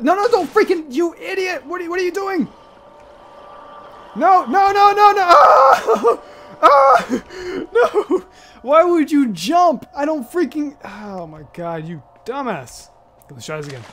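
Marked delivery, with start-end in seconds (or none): 0.0-10.8 frantic high shouting, laughing; screaming in panic at the screen; panicking gamer. Real, not acted. Character jumps into water, panic.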